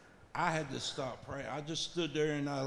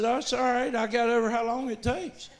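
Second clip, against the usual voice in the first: much higher and louder, bright eager encouragement; cheerful reassurance; 9 semitones higher, 6 dB louder than usual. Retold or imitated. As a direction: lift it up high and loud to cheer her on. Reassuring the lady, animated.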